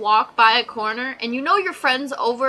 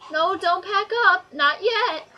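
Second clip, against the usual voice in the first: higher, slow mock teacher; put-on teacher voice, pitched up; imitating a teacher; 7 semitones higher, slower than usual. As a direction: drag out the teacher's order in mock style. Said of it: "your teacher's like".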